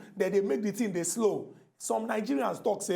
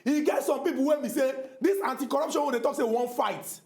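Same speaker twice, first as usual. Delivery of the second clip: normal talking voice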